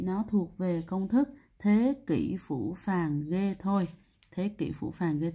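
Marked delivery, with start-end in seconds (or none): 1.6-3.9 slow, each word held out; slow recitation. Shown as a demonstration. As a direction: spell out the phrase word by word. Teacher recites memory formula slowly. (đọc chậm, kéo dài từng chữ)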